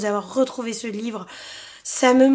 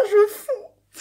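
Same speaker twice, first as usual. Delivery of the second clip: laughing squeal; 8 semitones higher than usual. Real, not acted. Melting over favourite book.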